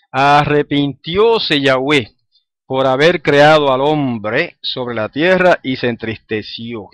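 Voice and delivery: slow, deliberate reading of the verse; scripture reading. Acted. Teacher reading Genesis to the class, slow and solemn. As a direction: read the verse with weight, slowly. Reads verse six aloud.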